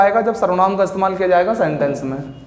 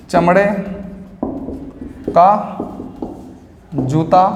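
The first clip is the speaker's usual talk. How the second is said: one word at a time with long gaps; slow dictation; slower than usual. Shown as a demonstration. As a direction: spell it out slowly for the class to copy. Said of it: "teacher dictates word by word". (रुक-रुककर धीमा लिखवाना)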